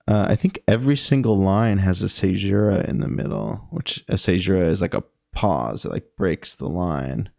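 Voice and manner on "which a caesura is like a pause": usual speaking voice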